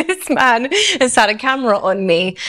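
high, incredulous, through laughter; amused amazement. Retold or imitated. Newcomer amazed at what pastor knows, laughing. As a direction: marvel laughingly, as if you've been spied on.

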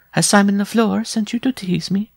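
high-pitched woman's voice; amused giantess. A narrator's reading. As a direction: question him in a high, teasing lilt. Giantess questions her tiny suitor.

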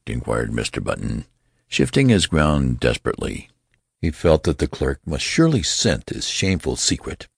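ordinary delivery

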